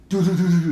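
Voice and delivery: quick, loud "do-do-do" stomps; punchy vocal stomp sound effect; imitated stomping. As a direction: mimic feet stomping down stairs. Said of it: "stomps back down the stairs".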